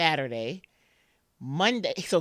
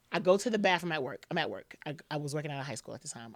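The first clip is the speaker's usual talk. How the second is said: ordinary talk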